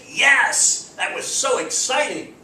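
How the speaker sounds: loud, high-pitched cheer; excited exclamation. Shown as a demonstration. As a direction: burst out with demonstrated excitement. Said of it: "you would probably have the exclamation point".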